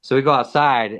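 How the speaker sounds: ordinary talk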